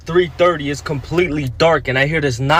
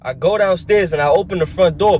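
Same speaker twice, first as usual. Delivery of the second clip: ordinary delivery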